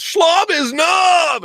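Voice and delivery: slow, high, slurred hollering from afar; drunken shout. Retold or imitated. Drunk woman heckling the proposal from the hotel, slurred yell. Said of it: "some chick back there goes".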